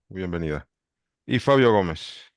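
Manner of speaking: usual speaking voice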